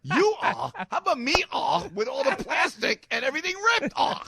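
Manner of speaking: high, loud, hammed-up outrage; mock indignant comeback. Passenger complaining to driver about the ripped car, mock indignant. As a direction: throw the driver's groan back in mock indignation.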